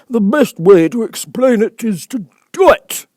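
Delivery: high, loud, important bird voice; grand old bird holding forth; pompous Dodo. Acted. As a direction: puff up like a self-important Dodo.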